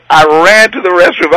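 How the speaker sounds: usual speaking voice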